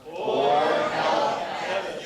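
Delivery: loud, very drawn-out chant; wholehearted group recital. Real, not acted. Congregation repeats after being urged, loud and emphatic. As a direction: belt it out slowly, really meaning it. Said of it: "You can really say that if you wanted to".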